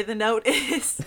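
ordinary delivery

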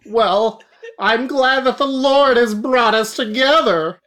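high pious preacher voice; a high, kindly priest greeting; Armadillon. Acted. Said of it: "It's Father Armadillo Don now".

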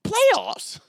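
squeaky incredulous high-pitched Jim Mora yell. Retold or imitated. Mimicking a coach's disbelieving rant to the listeners, very high shout. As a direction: shriek the word like a coach who can't believe the question. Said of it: "famous Jim Mora Senior".

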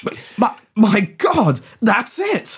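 stammered, high-pitched epiphany; stagey astonished breakthrough; sudden realisation. Acted. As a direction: blurt it as the answer dawns on you.

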